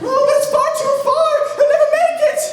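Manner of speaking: very high falsetto wail; hammed-up damsel protesting in a piercing pitch; distressed maiden. Maiden answering the crowd that says jump, falsetto distress.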